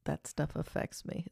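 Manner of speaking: normal talking voice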